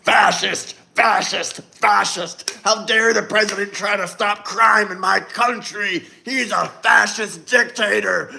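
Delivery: hammed-up shrieking protester; mock outrage, overdone for laughs; mocking a hysterical Democrat. Streamer mocking Democrats, hammed-up hysterical yelling. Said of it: "That's exactly how the Democrats sound".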